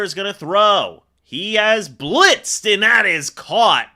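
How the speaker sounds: building, excited play call; lively sportscaster delivery. Real, not acted. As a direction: call the snap, then punch the catch loudly.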